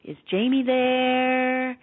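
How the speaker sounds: put-on slow, faded, lightly French-accented man, stretching out "there"; a hint of a French husband calling slowly from far away; ghostly French accent, "there" drawn out long. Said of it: "the voice had an accent, and he extended the syllable "there".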